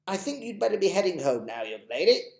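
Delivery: put-on potter about like a polite old gent; lightly voiced, dotty old gentleman; fussy old gentleman. Mister Stink sending Chloe home.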